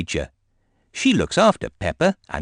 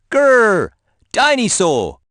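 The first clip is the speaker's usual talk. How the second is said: put-on loud, much higher toddler roar; dinosaur roar; 13 semitones higher, 7 dB louder, slower than usual. A narrator's reading. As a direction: roar to scare the teacher. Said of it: "George shakes his toy".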